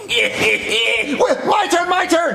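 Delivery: shouted demand. Acted. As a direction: yell it like a spoiled child. Kid demanding his turn.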